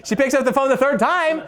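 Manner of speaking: ordinary talk, nothing marked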